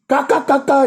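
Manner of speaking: rapid high-pitched "ka" knocks; imitated knocking. Preacher shows Jesus knocking at the door, imitated knocking. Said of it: "je frappe dans ta porte".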